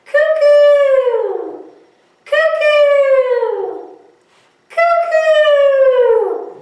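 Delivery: very high, loud cuckoo call falling away. Acted. Teacher playing the cuckoo bird for the kids, high and loud. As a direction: pop out like the clock bird. Cuckoo clock striking in song.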